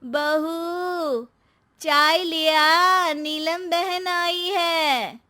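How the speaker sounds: slow drawn-out call toward the kitchen. Acted. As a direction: stretch each word, calling to the next room. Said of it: "सास एक बार फिर से पुकारती है".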